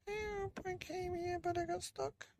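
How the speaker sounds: very high, soft cat voice; pitiful trapped cat. Acted. Trapped cat pleading with the old man, tiny high voice.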